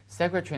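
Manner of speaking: "Secretary" shortened British-style to "secretry"; British pronunciation. Shown as a demonstration. Teacher names the British reading, clipped-vowel "secretry". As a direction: drop the vowel in "-tary" of "Secretary". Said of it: "是比较英式的发音".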